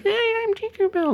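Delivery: tiny high pixie voice; squeaky little fairy. Retold or imitated. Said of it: "a little tiny pixie voice".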